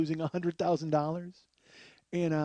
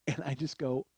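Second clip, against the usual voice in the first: normal talking voice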